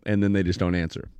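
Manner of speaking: ordinary delivery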